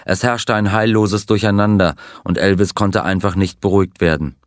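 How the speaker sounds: usual speaking voice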